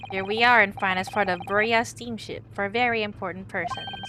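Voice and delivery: put-on loud, blunt Russian-accented crewman; proud Russian sailor introducing himself. Crewman Biff correcting the lawyers, loud Russian accent.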